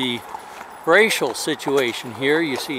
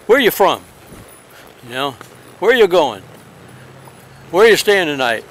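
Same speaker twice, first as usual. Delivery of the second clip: talking down; 2 semitones higher, 4 dB louder than usual. Retold or imitated. Police officer questioning a stranger, retold talking down. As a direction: grill him like a suspect. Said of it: "just the way he talked".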